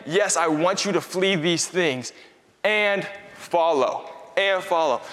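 "Flee these things and follow" read very slowly, FLEE stressed; slow emphatic reading. Shown as a demonstration. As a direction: linger on each word, leaning on "Flee". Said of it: "Paul is saying".

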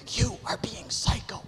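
harsh forced whisper, hissed with urgency; whisper-yell it like an angry parent. Shown as a demonstration. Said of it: "I mastered the whisper yell".

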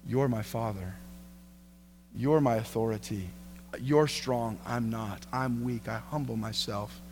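low, soft prayerful confession; humble submission. Acted. Humble submission spoken to God.

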